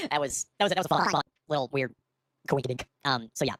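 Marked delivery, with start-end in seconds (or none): none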